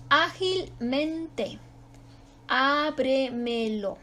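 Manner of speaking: Ágilmente and Ábremelo slow, with a long opening Á; slow syllable demonstration. Shown as a demonstration. Teacher giving examples, slow Ágilmente, Ábremelo with long first syllable. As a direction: stretch Á, then measured syllables in Ágilmente, Ábremelo. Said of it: "es sobre esdrújula y se acentúa".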